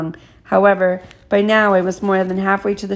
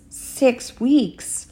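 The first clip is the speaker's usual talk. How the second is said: higher, drawn-out incredulous echo; taken aback; 5 semitones higher than usual. A narrator's reading. Father hears how long the wait is, taken aback. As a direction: repeat it slowly in disbelief.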